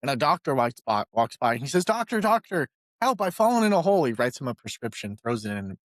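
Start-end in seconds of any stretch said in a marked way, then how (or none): 1.8-4.0 hammed-up plea from the bottom of a hole; cry for help. Man stuck in a hole calls to a passing doctor, mock-desperate plea.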